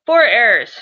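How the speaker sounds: a loud playful mock yell. Shown as a demonstration. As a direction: call it out like a warning. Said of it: "she yelled".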